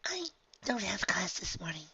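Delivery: soft high filly voice; hushed, light young filly; chiming in softly. Acted. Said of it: "Honeydew chimed in".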